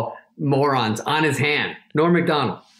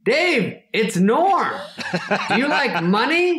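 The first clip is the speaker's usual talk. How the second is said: high, emphatic impression; Norm Macdonald; 8 semitones higher, slower than usual. Comedian luring friend by text, imitated eager pitch. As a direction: pitch it like an eager salesman, voice raised. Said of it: "He just goes".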